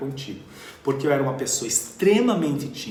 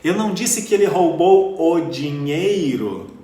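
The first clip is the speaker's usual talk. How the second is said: "dinheiro" emphasized, higher and louder, slow; stress on DINHEIRO; 5 semitones higher, 11 dB louder than usual. Shown as a demonstration. Teacher demonstrating stress on the final word "dinheiro". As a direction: land the weight on "dinheiro". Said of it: "Então o que foi que ele roubou?".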